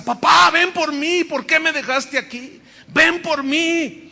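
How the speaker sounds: high, loud, slow begging; pleading with grief. Retold or imitated. Daughter alone in her room begging her father, slow anguished plea. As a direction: plead to the dead father, drawn out.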